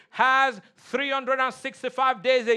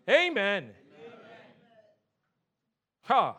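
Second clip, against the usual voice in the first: ordinary delivery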